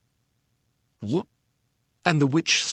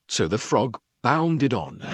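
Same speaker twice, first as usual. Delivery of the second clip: ordinary delivery, nothing marked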